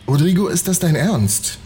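high, outraged yell; shouting at him. Acted. Carlotta confronting Rodrigo about the theft, high angry yell. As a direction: fling the question at him in a high, angry cry. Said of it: "schrie Carlotta ihn an".

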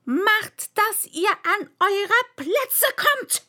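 high, loud, drawn-out command; thundering queen. A narrator's reading. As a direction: thunder the order, stretching each word. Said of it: "donnerte die Königin".

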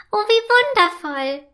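swooning with wonder; delighted. A narrator's reading. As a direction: ice elf delighted. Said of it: "findet die Eiselfe".

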